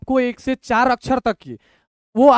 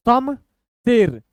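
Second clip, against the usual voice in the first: slow, pause between the two halves; broken in two; much slower than usual. Shown as a demonstration. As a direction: read it two letters at a time. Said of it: "दो दो अक्षर तोड़ तोड़ के".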